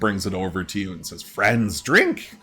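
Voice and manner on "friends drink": bird creature. Acted. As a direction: squawk it out like a frantic bird-man. Voices Pretty Bird.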